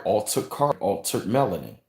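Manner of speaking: usual speaking voice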